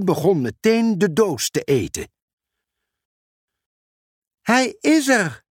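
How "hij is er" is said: high, slow excited call. Acted. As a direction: call out with delight. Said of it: "riep Mera".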